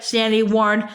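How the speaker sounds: ordinary delivery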